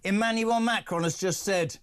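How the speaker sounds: high, slow newsflash announcement; breaking news announcer. Pretend. Radio host cutting in on the guest, announcer voice. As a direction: announce it like an urgent radio bulletin, drawn out.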